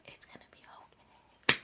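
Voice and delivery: hushed whisper to a friend. Retold or imitated. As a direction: whisper so the teacher won't hear.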